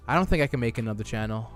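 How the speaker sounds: ordinary talk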